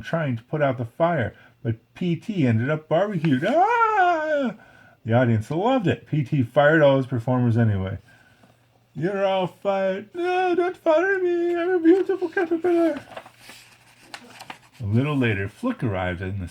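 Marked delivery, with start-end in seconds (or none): 3.5-4.6 shrill burnt yelp; high cry of pain. A narrator's reading. Flea manager burnt in his act, yelping. PT barbecued, cries out. 8.9-10.1 higher, slow ringmaster sneer; slow bossy firing. A narrator's reading. Circus manager firing all his performers. 10.1-13.0 very high, whiny pleading voice; pleading caterpillar voice. A narrator's reading. Caterpillar begging the manager not to fire him.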